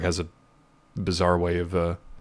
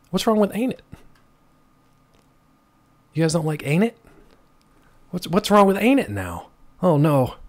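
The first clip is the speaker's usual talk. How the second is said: mock offended; 7 semitones higher than usual. Real, not acted. Defending ain't it to chat.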